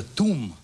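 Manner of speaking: "tomb" said as "toom"; long oo in "tomb", with the m closing it; mispronounced tomb. Shown as a demonstration. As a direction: say "tomb" to rhyme with boom. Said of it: "Should be tomb".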